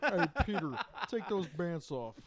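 loud, slow cartoon voice; drawn-out silly character voice; Family Guy voice.

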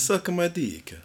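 high-pitched cartoon Italian; bad Italian accent. Repeating his joke for a puzzled friend, bad Italian accent. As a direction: do a hammy, unconvincing Italian accent. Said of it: "Yeah, it's, like, Italian".

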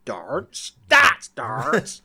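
darts with a rolled-in R, tɑɹts; English darts. Retold or imitated. English friend correcting the Boston student. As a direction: correct him slowly, stressing the R. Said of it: "he's like".

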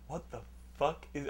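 low-volume, baffled mutter; muttered bafflement. Retold or imitated. Brother hears stomping in the entryway, muttered bafflement. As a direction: wonder aloud under your breath.

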